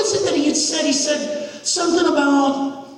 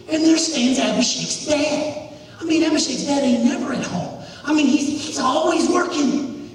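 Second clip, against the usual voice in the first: ordinary delivery, nothing marked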